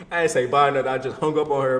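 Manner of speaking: ordinary talk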